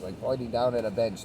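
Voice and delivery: normal talking voice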